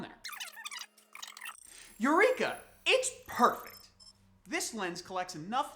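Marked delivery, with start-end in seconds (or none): 2.0-3.8 loud, high-pitched acted delight; triumphant discovery. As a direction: exclaim like an inventor's breakthrough. Acts delight at lens test.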